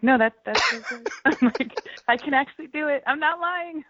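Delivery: high-pitched, laughing protest; mock protest. Imitating herself protesting to a director, high-pitched mock indignation.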